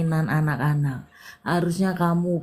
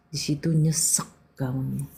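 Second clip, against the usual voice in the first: ordinary talk, nothing marked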